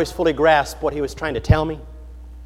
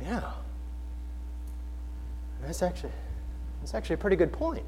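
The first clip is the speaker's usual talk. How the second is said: much softer, halting thinking aloud; quiet musing; 4 semitones lower, 16 dB quieter, faster than usual. Retold or imitated. Preacher mulling over his translator's point, quiet musing. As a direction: muse softly, as if to yourself. Acting out his pondering reaction.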